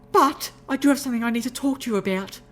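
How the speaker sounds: high refined lady's voice, turning grave; high and ladylike, now serious; serious refined unicorn. Acted. Rarity changing the subject, high grave ladylike voice.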